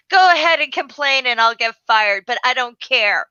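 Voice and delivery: loud, heated defiance; retold telling-off. Bookseller tells off an abusive customer, defiant and loud.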